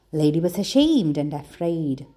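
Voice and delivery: ordinary delivery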